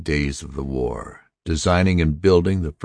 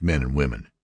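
ordinary delivery

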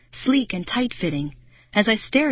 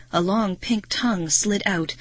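ordinary delivery, nothing marked

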